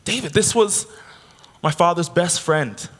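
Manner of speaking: mother proudly introducing her father's best friend. Retold or imitated. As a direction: present the old friend with pride. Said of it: "she goes".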